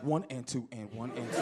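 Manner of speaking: low, soft counting under his breath; careful step counting. Acted. Nervous teen counting his steps down the street, low hushed count.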